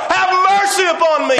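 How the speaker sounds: desperate cry. Retold or imitated. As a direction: shout it over those hushing you. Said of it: "He cries even more".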